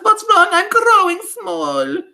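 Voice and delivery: very high, loud squeal; mock cry of alarm. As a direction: witch mimicking a panicked boy. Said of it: "cries out".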